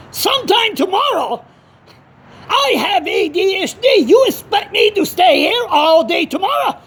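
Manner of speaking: high-pitched indignant outburst; outraged disbelief. Retold or imitated. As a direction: throw it back way up high, appalled. Echoes caller's offer in outrage.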